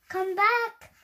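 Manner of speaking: loud, high Come back!; crying out. Acted. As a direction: call after the bat out the window. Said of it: "Skelly Nelly cried".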